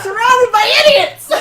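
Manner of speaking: high, loud, laughing exasperation; exasperated outburst. Real, not acted. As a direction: cry out in mock despair at your friends. Exasperated outburst at fellow players.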